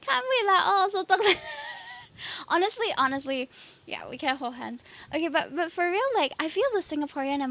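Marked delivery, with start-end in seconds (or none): 0.0-1.4 loud Singlish, breaking into laughter. Pretend. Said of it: "Singlish redeem".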